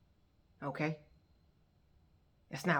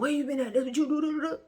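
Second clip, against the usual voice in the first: high, loud babbling mimicry; mocking nagging; 9 semitones higher, 4 dB louder, faster than usual. Imitating him waiting up for her, mocking nag. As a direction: imitate his nagging, trailing into nonsense syllables.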